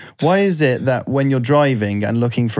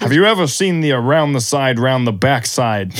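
wordy American impression, teasing; mock American. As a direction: put on an exaggerated American accent. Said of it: "They always say long words".

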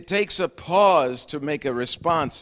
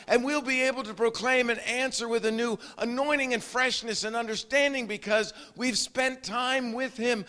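raised, fervent preaching voice; 8 semitones higher than usual. Acted. Preacher stirring his congregation, fervent preaching. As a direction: preach it with fire to the church.

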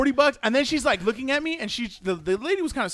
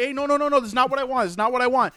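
higher-pitched mock protest; alarmed refusal; 6 semitones higher, much faster than usual. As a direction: push her off in a flustered rush. Refusing the masseuse in retelling.

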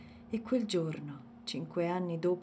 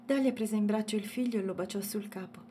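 ordinary delivery

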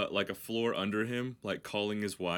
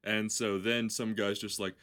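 ordinary talk

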